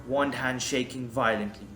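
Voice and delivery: usual speaking voice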